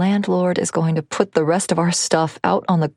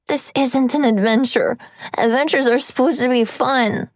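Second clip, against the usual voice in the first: put-on upset child; 7 semitones higher than usual. Boy accusing mother.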